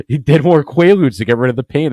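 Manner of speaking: cracking up at his own story; amused, laughing it out. Real, not acted. Laughing at his dad's antics.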